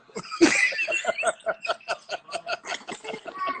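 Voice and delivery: long, high wheezing laugh; helpless high-pitched laughter, fairly soft; cracking up. Real, not acted. Reacting to his own foot joke, cracking up high.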